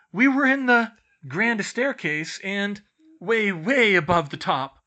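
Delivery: loud, high, halting panic; breathless, incoherent blurting. A narrator's reading. Twins stumble in to report, loud and incoherent. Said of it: "out of breath and incoherent".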